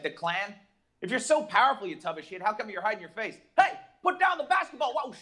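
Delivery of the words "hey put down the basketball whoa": high, loud yell; hammed-up yell; mock shout. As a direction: call it out like a nervous bully. Klan member calling out.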